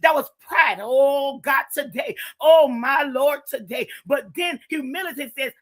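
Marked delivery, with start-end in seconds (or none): none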